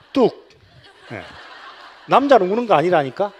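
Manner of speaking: sharp, loud mock scolding of a crying boy; stern hush. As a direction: bark at the boy to stop crying, half laughing. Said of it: "남자애가 울면 뭐라고 하는가". (우는 남자아이에게 웃으며 크게 호통치듯)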